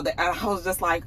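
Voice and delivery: ordinary talk, nothing marked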